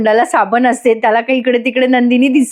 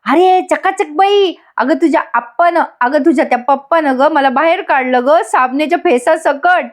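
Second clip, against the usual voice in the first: imitated pleading call; 4 semitones higher than usual. Imitating soap-covered Jiva calling up to Nandini, mock pleading. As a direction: call up in a pitched-up, hammed-up plea. Stranded husband calls up, pleading.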